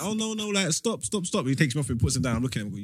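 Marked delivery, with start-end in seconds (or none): 0.0-1.4 flustered social worker. Retold or imitated. Voicing flustered social worker being climbed on.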